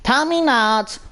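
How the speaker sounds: ordinary delivery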